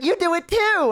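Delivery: high-pitched, insistent cute maid; cutesy maid. Acted. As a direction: coax like a squeaky café maid. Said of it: "she's gonna be like".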